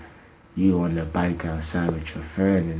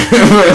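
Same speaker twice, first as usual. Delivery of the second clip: short laugh; 13 dB louder than usual. Real, not acted. Laughing at own joke.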